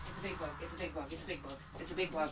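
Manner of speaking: frightened murmur. Real, not acted. Daughter repeating quietly about the bug to her mom. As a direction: mutter it over and over, scared.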